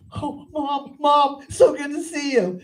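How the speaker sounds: high-pitched, clingy son voice; hammed-up high voice of a needy boy. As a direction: imitate a grown son gushing in relief. Clingy tripping son greets mother.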